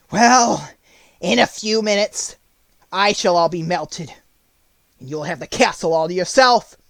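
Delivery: loud, halting witch voice; a melting witch's resigned farewell; dying witch. A narrator's reading. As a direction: speak in broken phrases as a melting witch. Dying witch speaks her last words.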